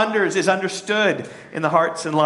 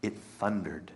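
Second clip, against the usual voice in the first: dismissive; 8 semitones lower, 12 dB quieter than usual. Retold or imitated. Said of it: "They're saying".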